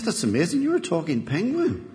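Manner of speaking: lightly acted wonder at what is in front of you; amazed barman. Barman marvels at a talking penguin, mildly amazed. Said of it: "barman turns round in absolute shock".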